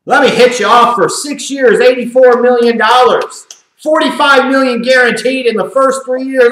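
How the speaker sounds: hammed-up eager big spender; mock Redskins front office. A commentator voicing the Redskins front office as an eager big spender. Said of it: "the Redskins looked at that and said".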